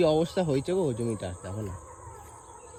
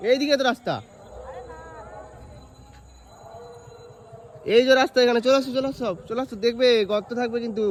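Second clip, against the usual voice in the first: high, loud call across a field; 8 semitones higher, 4 dB louder than usual. Real, not acted. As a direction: call them over from a distance. Calling family across the field.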